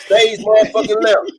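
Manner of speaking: high, loud comic shout; loud mock cry. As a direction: yell it like a stage exit.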